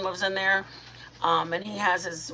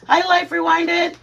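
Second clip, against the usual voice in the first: high, loud, slow greeting; excited hello; 11 semitones higher, 8 dB louder, slower than usual. Real, not acted. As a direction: sing out a happy hello. Greeting a viewer.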